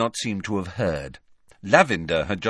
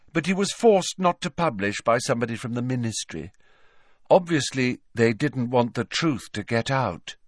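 raised, untroubled character voice; Luna, matter-of-fact; 6 semitones higher than usual. Acted. Luna telling Harry of her father's article, matter-of-fact. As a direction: explain an odd theory as plain fact.

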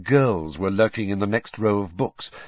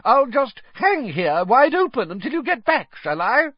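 high, louder, biting sarcasm; irritable fat lady; 5 dB louder than usual. A narrator's reading. The fat lady complaining at the students left wide open, high and sarcastic. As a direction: scold them sweetly and sourly at once. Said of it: "the fat lady called irritably".

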